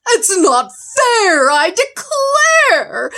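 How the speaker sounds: high, slow, wailing protest; panicked bear wailing at the unfairness. Acted. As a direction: cry out at the unfairness.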